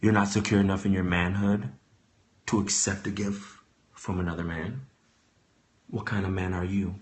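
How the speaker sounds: low, quiet impression of Mister Willie; low mock Mister Willie. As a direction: mutter it low and hurt. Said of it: "this is what he hit me with".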